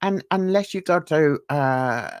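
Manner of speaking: usual speaking voice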